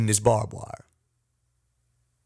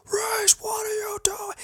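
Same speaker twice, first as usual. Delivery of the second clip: imitating a drill sergeant's very high, strained yell; mocking, high-pitched drill instructor tirade; 3 dB quieter than usual. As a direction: bark at the recruit like an angry drill instructor. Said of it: "and he's like".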